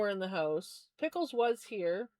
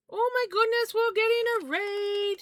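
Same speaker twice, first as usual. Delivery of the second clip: high, loud happy surprise; thrilled burst at the incoming raid; excited; 12 semitones higher, 6 dB louder than usual. Real, not acted. Surprise raid arrives during stream.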